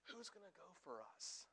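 hushed, intimate whisper of the question; whispering God. Acted. Pastor voicing God whispering to Isaiah, hushed whisper. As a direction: breathe it like a secret to someone beside you. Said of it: "he's kinda whispering here".

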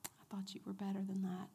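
soft hissing whisper of disappointment; whispered accusation. Retold or imitated. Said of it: "he will turn around and say".

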